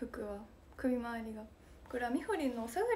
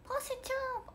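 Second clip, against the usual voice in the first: much higher, soft call of a name; impression; 13 semitones higher, 5 dB quieter, much faster than usual. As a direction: call sweetly in someone else's voice. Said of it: "これ人間のモノマネです".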